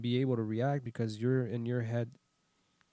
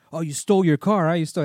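higher, much louder driver's taunt; loud acted accusation; 5 semitones higher, 13 dB louder than usual. Driver attacking him verbally, loud mock accusation. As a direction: accuse loudly as the ranting driver. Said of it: "tried to attack me and then say".

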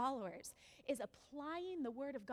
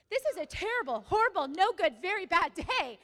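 loud, higher, hammering each phrase; exasperated outburst; 4 semitones higher, 10 dB louder than usual. Retold or imitated. Mother finding a tree on her car, loudly exasperated. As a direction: proclaim it in loud mock despair.

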